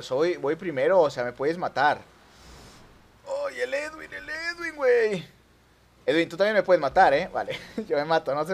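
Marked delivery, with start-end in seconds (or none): 3.3-5.3 soft, high-pitched wail. Real, not acted. Player fretting over Edwin, soft high wail. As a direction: whine his name up high.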